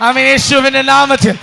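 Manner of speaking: loud revival proclamation building in fervour; full-force preaching. Real, not acted. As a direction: shout the blessing over the whole church.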